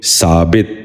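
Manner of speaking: ثابت ending "-bit", short i; short i before the final t of ثابت; correct pronunciation. Shown as a demonstration. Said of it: "دوبارہ سنیے".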